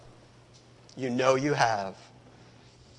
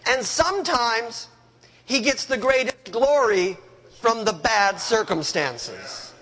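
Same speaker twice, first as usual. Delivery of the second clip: usual speaking voice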